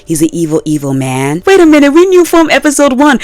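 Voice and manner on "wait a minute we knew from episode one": high, louder mock surprise; sarcastic aside. Real, not acted. Telling viewers the twist was obvious, high mock surprise. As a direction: gasp it out as fake shock.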